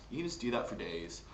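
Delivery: ordinary talk, nothing marked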